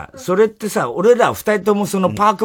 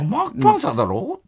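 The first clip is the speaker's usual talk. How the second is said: mocking partner's insistence; 6 semitones higher, faster than usual. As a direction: ham up the partner insisting it's マークパンサー. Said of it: "みたいな".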